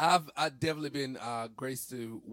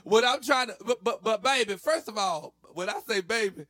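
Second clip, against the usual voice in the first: high, loud sassy scolding; 9 semitones higher, 10 dB louder than usual. Pretend. To an imagined person, a high loud sassy scolding. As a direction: scold them with full attitude.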